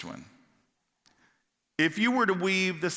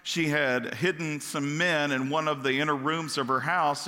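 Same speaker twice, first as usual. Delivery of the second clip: ordinary talk, nothing marked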